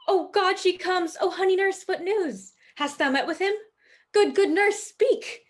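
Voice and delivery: high, loud, impatient pleading; breathlessly eager, pitched well up; eager excitement. Acted. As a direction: burst out, desperate for the news. Juliet begs waiting nurse for news.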